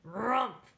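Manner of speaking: short gruff grunt; bear grunt. A narrator's reading. The bear grunts goodbye to Baba Brena, short gruff grunt.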